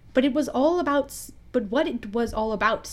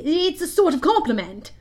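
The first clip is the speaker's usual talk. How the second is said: higher, louder character voice; Smee the pirate; 6 semitones higher, 4 dB louder than usual. A narrator's reading. Smee reminding his captain about his weapon, in a high pirate-sidekick voice. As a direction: pipe up as the loyal sidekick. Smee's voice in story.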